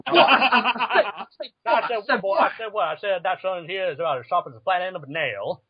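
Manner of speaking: stutter-repeat like a Southern cartoon rooster; Foghorn Leghorn. Co-host joining the rooster bit, blustering Southern voice. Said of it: "the Foghorn Leghorn thing".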